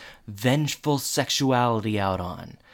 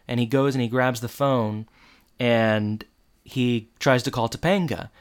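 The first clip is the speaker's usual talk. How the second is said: ordinary talk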